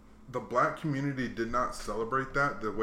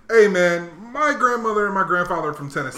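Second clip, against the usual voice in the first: loud, raised, offended comeback; mock-offended loud retort; indignant outburst; 5 semitones higher, 7 dB louder than usual. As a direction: bristle and push it out louder. Indignant reply in retold talk.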